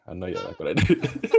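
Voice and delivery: chuckling through the line, pleased with himself; laughing. Real, not acted. Podcaster pleased with his own meme pun, laughing. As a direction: laugh it out like you landed a joke.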